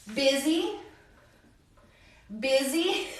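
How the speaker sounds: "Busy" as BIH-zee, softly, rising; pronunciation model. Shown as a demonstration. Teacher shows how busy is pronounced, soft rising BIH-zee. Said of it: "pero no se pronuncia con U".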